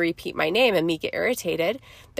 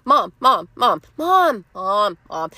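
imitating a kid calling on repeat, pitched up then tailing off; nagging child; slower than usual. Child repeatedly calling a busy mother, mock nagging. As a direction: pester like a child wanting attention. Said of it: "when a child says our name fifty times in a row".

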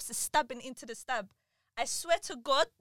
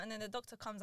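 ordinary delivery

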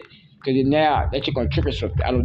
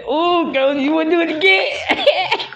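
laughing tease; 12 semitones higher than usual. Pretend. Teasing laugh voice.

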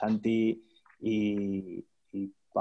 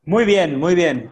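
enthusiastic praise; 7 semitones higher, 8 dB louder, slower than usual. Real, not acted. Host wrapping up enthusiastically.

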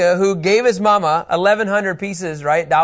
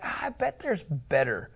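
dismissive grumble; 6 semitones lower, 10 dB quieter than usual. Retold or imitated. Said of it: "they're like".

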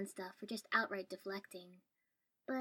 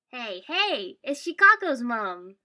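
loud, high call across the street; cheerful yell; 4 semitones higher, 17 dB louder, slower than usual. A narrator's reading. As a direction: yell out cheerfully to someone far off. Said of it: "yelling cheerfully across the street".

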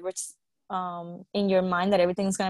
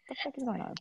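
much softer, higher aside; hushed disbelief; 4 semitones higher, 12 dB quieter, much faster than usual. Retold or imitated. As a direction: retell it under your breath. Retelling own chills and shock.

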